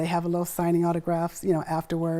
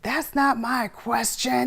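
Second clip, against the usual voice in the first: put-on slow, higher, sulky child voice; kid's attitude; 5 semitones higher, much slower than usual. Mom imitating son sassing co-host, huffy. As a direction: talk back like a huffy six-year-old. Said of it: "he got an attitude with it".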